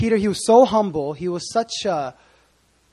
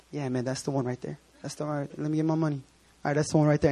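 sneaky Judas; 4 semitones lower, 6 dB quieter, faster than usual. Retold or imitated. Judas pointing Jesus out quietly.

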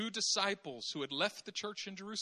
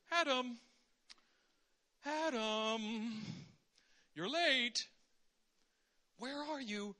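mock-innocent calling. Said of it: "God pretends not to know".

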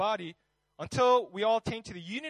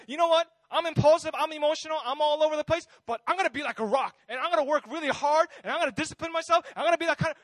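higher, louder hammed-up bravado; mock self-pep talk; 6 semitones higher, 7 dB louder, faster than usual. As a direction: puff yourself up like a boastful Peter. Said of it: "work up his own will and his own strength and be like".

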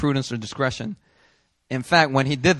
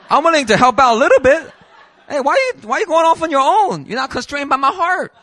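high, loud laughing protest; flustered protest; 12 semitones higher, 7 dB louder than usual. Retold or imitated. Young driver stopping his lawyer friend, flustered and high. Pleading as lawyer goes too far.

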